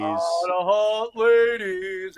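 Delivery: slow, drawn-out mimic of a friend's catchphrase; imitating a friend. Host quoting a friend's catchphrase, slow, lightly mimicked. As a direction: stretch it out like his buddy says it. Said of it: "as he always says".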